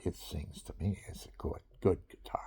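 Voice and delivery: breathes it out in an awed whisper; a hushed, reverent whisper. Real, not acted. Praising his guitar to the viewer, in a soft whisper. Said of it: "Instead of me singing to it".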